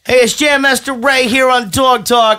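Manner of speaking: loud, hammed-up radio host; mock show host. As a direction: open like a brash dog-show DJ. Performs his mock dog show.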